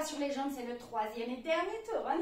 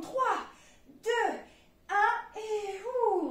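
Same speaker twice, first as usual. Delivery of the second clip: loud countdown; 6 semitones higher, 5 dB louder, much slower than usual. Real, not acted. Coach counting down loudly.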